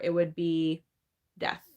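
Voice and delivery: normal talking voice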